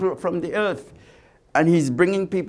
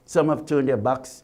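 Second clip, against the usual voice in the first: ordinary delivery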